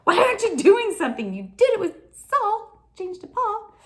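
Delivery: high, loud, insistent protest; pushing God. Retold or imitated. Believer pushing God over Saul, insistent high protest. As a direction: push hard with a pleading, accusing demand. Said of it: "so I'm pushing God, going".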